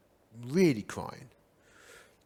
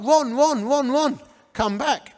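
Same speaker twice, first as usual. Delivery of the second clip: calling out; 7 semitones higher, slower than usual. Retold or imitated. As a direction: call them back before they go. Calling the elders back.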